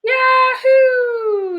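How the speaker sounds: high, loud whoop of delight; child's thrilled sledding whoop; excited yell. A narrator's reading. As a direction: shout for joy down the hill. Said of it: "yelled Nikki".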